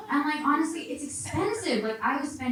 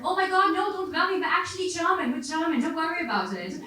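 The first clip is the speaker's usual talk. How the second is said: high, hurried reassurance; flustered dad; 8 semitones higher than usual. Acted. Dad defending the child to an offended stranger, flustered. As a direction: rush to smooth it over, pitched up.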